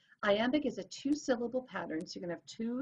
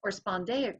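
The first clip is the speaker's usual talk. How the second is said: ordinary delivery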